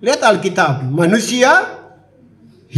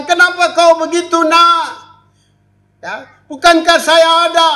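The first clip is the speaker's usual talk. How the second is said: God speaking; 7 semitones higher than usual. Acted. God voiced speaking to child.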